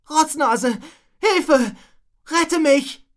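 loud, high cries for help; desperate cry. A narrator's reading. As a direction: shout desperately to be rescued. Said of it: "rief Rocco verzweifelt". (verzweifelt um Hilfe rufen)

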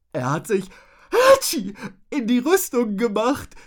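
high voice broken by a loud sneeze; high, loud, sneezy little tattler; giggly tattling. A narrator's reading. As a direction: tease gleefully, sneezing mid-sentence. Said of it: "stellte Rotznase kichernd fest".

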